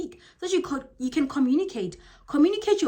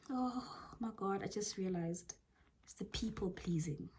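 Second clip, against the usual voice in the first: whisper; 2 semitones lower, 13 dB quieter than usual. Real, not acted.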